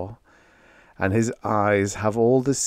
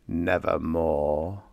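slow, a bit lower croak; slow croaking nevermore; croaking raven; 3 semitones lower, 4 dB quieter, slower than usual. Acted. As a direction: let the raven croak it out slowly. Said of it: "meant in croaking".

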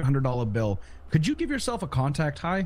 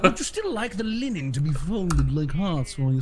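higher, put-on manservant voice; hammed-up obliging butler; servile butler; 3 semitones higher than usual. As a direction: fuss over your master as a butler. Plays butler offering linen.

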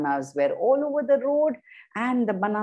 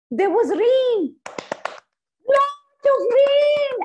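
dramatic storytelling; 14 semitones higher, 5 dB louder, slower than usual. A narrator's reading. Storm builds in story.

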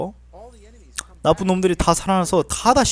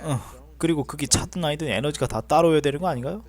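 ordinary talk

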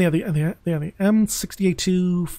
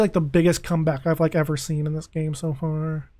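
ordinary delivery

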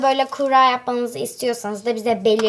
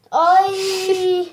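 gagging; 4 semitones higher, much slower than usual. Real, not acted. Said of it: "Ay, kusacağım".

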